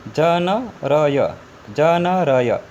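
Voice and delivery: ज न र य drawn out slowly, one by one; slow consonant reading. Shown as a demonstration. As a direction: sound out ज न र य very slowly. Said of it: "स्वर को हटा दिया". (धीरे-धीरे व्यंजन पढ़ना)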